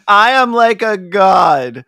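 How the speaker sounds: slow, loud pompous declaration; grandiose. Retold or imitated. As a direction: proclaim it like a self-made deity. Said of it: "it would be scary if it wasn't so funny".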